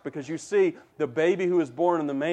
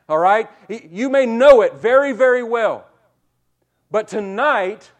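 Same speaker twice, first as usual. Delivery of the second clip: louder, driving sermon voice; full-force preaching; 8 semitones higher, 10 dB louder than usual. Acted. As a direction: preach it hard to the congregation.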